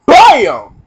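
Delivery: loud high "Bam!"; bam sound effect. Retold or imitated. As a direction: punch in the push sound.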